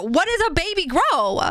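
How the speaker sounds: a hint of outraged echo; mildly mocking echo; mock indignant. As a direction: lightly echo the chat's indignant question. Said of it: "what do you mean, what's a baby grow".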